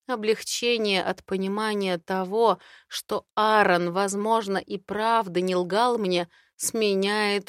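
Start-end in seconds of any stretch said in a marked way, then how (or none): none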